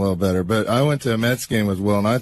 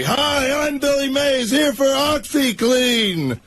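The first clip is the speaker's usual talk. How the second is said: very high, sustained pitchman holler; Billy Mays; 14 semitones higher, slower than usual. Acted. Re-enacting the party act for the host, imitated all-out pitchman yell. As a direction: belt it out like an infomercial yeller, sparing the microphone. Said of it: "I'd yell as loud as I could".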